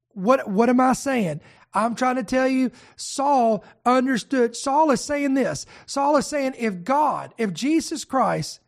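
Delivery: usual speaking voice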